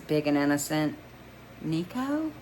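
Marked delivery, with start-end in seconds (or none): 1.6-2.3 trembling. A narrator's reading. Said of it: "Her voice is trembling".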